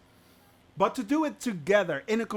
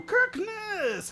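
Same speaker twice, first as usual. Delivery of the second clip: high, drawn-out greeting shout; excited high-pitched call; 14 semitones higher than usual. Real, not acted. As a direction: holler the name across to a buddy. Calling out greeting to a viewer.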